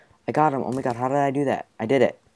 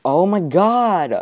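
slow, loud, high exclamation; dragged-out dismayed oh my god; drawn-out oh my god; 8 semitones higher, 8 dB louder, much slower than usual. Real, not acted. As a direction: stretch it out in dismay. Teammate says I'm done.

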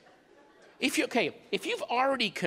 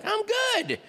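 mock cheerful; 13 semitones higher than usual. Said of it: "I'm like".

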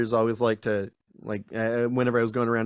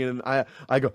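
ordinary talk

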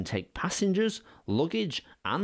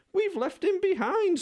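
much higher, louder mock sob; coaches sobbing; 5 dB louder than usual. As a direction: wail it like tearful carriages. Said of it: "sobbed Annie and Clarabel together".

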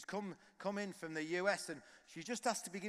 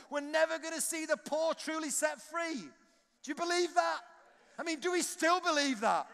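higher, louder, near-sung pleading; impassioned preaching; 7 semitones higher, 5 dB louder than usual. Acted. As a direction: plead with the congregation, rising to song. Pleading appeal at full force.

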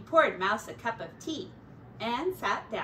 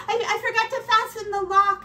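high, loud, very fast; panicked babble; 11 semitones higher, 9 dB louder, much faster than usual. A narrator's reading. Mouse realizes the door is unlocked, frantic and fast. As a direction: gabble it out in a frightened rush. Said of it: "running around his room".

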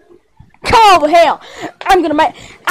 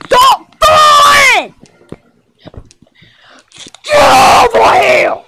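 high, drawn-out shouted order; angry yell; 7 semitones higher, slower than usual. Pretend. One toy ordering another about, high angry yell. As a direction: yell it high, stretching the words. Acted quarrel between toys.

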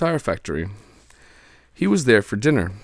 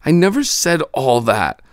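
loud protest; 7 semitones higher, 6 dB louder than usual. Acted. Amanda protests.

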